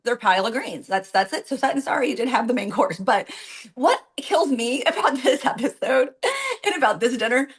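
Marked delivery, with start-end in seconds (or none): none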